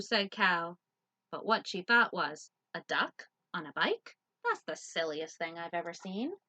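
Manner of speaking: lively loud picture-book read; reading to children. Acted. Teacher reading Duck on a Bike to children. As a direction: read it out big for little listeners.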